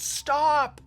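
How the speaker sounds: sudden high yell; screamed warning. A narrator's reading. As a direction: shout it to stop him. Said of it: "screamed out".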